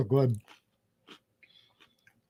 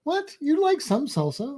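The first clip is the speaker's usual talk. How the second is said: surprised; 9 semitones higher than usual. Real, not acted. Shocked she dislikes salsa.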